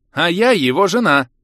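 high, loud blurt. A narrator's reading. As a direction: blurt it like a bombshell. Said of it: "брякнула Елена". (выпалила)

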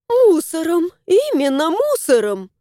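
zealous yes-man echo; eager agreeing. A narrator's reading. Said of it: "поддакнула Алевтина".

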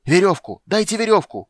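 very high, loud frantic demand; panicked high yell; shouting. A narrator's reading. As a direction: scream for help from above. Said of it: "кричал Лютик".

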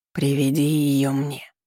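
slow, eerie voice from nowhere; haunt her from inside her head; sinister voice. A narrator's reading. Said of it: "Этот зловещий голос звучит в её голове".

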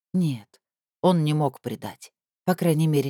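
usual speaking voice

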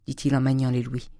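ordinary delivery, nothing marked

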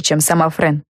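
plain reading voice

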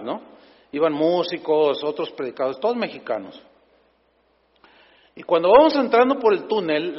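ordinary talk

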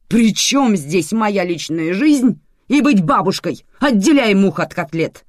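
loud, high outburst; angry shouting. A narrator's reading. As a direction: shout it back indignantly. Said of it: "закричала Светлана Степановна".

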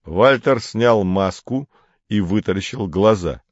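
ordinary delivery

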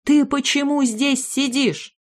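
high, slow bewildered question; disbelief. A narrator's reading. Mother asking her son why he sits there, bewildered. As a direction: ask it slowly in disbelief.

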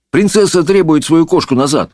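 high, official announcement; calling messenger. A narrator's reading. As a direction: proclaim the royal demand. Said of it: "крикнул гонец".

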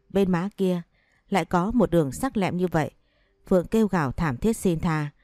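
usual speaking voice

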